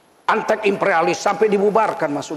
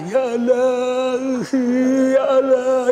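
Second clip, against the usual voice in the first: drawn-out tearful "Ya Allah"; slow, wailing plea to God; mock crying prayer; 4 semitones higher, much slower than usual. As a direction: imitate a weepy preacher, stretched slow. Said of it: "tukang nangis".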